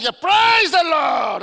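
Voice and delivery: loud shouted praise, stretched long; exuberant church praise. Retold or imitated. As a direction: belt it out like a rejoicing congregation. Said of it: "I know how Afro-Americans rejoice when you go to churches".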